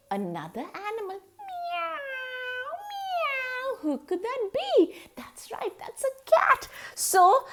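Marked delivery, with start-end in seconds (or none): 1.4-3.8 high, soft little meows; soft high kitten mewing; cat meow. A narrator's reading. Cat arrives in story. 3.8-6.3 secretive whisper; hushed playful whisper; whispered guess. A narrator's reading. Teasing guessing game with child.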